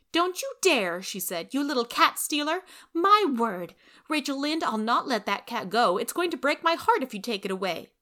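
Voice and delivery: put-on a girl's loud demand; child demanding. Anne demanding to know the fuss, high and loud.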